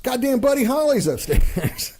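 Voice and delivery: mock excited yell, pitched high; hammed-up cry of amazement; excited shout. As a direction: crowd bursting out with the news. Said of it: "People started running out on the street and go".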